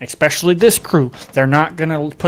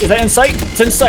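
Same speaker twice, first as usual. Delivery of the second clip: higher, louder hype call; excited caster; 7 semitones higher, 4 dB louder than usual. Real, not acted. Caster hypes the angle.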